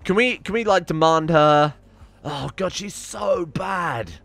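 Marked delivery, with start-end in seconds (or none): 2.2-4.1 slow, drawn-out exasperated cry, higher and softer; long exasperated wail; exasperated groan. Real, not acted. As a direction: groan it out in disbelief at how bad she is. Exasperated at his useless daughter.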